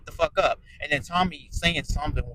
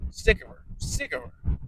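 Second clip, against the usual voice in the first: whisper; 7 semitones higher than usual. Real, not acted. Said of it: "I'm sick of her".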